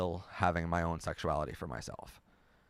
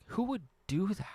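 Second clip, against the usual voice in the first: high-pitched, indignant disbelief; appalled, high rhetorical question; incredulous; 13 semitones higher than usual. Real, not acted. Indignant on behalf of disbelieved viewer.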